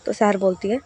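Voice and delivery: plain reading voice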